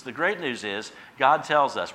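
ordinary talk, nothing marked

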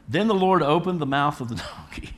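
laughing through the verse; can't hold back a chuckle while reading; reading scripture through a real laugh; chuckling. Real, not acted. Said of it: "I told you I'd chuckle".

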